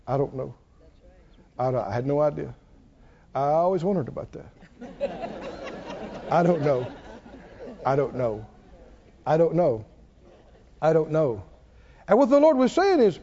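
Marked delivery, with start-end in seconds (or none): 0.1-4.5 low, soft, sheepish admission; mumbled climb-down, acted out; Job. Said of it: "Job would have to say". 4.8-11.4 low, sheepish repeated admission; acted-out humbled answer, deep and subdued; Job. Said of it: "Job would have to say".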